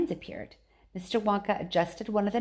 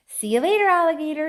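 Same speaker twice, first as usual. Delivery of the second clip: high, loud cocky goodbye; shouting; 8 semitones higher, 8 dB louder than usual. A narrator's reading. Mike shouts goodbye before jumping, gleeful. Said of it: "he shouted".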